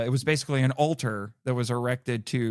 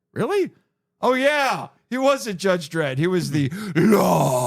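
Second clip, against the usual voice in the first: suddenly it clicks, excited; excited realization; 4 semitones higher, 4 dB louder than usual. Retold or imitated. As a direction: pitch jumps up high in sudden recognition. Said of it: "And I'm like".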